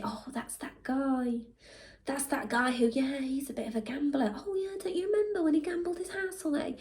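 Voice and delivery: lightly mock the gossips; gossiping voice. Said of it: "they say".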